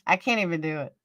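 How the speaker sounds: ordinary talk, nothing marked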